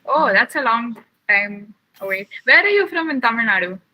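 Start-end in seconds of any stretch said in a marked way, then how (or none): none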